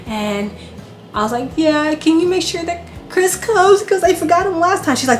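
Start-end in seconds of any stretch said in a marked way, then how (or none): 1.6-5.0 pleading request. Retold or imitated. Asking for her husband Chris.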